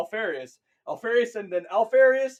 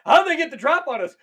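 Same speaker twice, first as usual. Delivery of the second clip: higher and louder, alarmed and laughing; panicked soldier; 7 semitones higher, 4 dB louder than usual. Retold or imitated. Ambushed soldier panicking over the indecipherable comms. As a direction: panic as the door comes in. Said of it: "It's just like".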